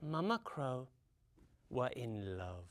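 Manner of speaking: soft, low, sinking gently; tender, in love. A narrator's reading. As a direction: let the love story melt downward softly. Mother crow named tenderly, in love.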